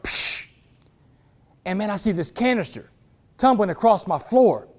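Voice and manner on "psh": sharp hissed "psh" of shattering glass; breaking window noise. Retold or imitated. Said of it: "I hear a window break".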